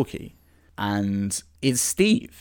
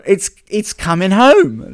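higher, louder excited cry; 7 semitones higher, 8 dB louder than usual. Acted. The boys hearing the roller disco comes to Hawkins, excited cry. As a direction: burst out with thrilled disbelief.

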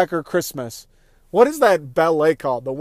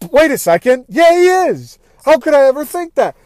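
higher and louder, rushing to the realization; suddenly sure he's the bad guy again, voice jumping up; panicked realization; 9 semitones higher, 5 dB louder than usual. Retold flip-flopping inner panic.

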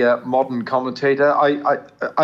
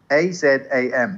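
normal talking voice

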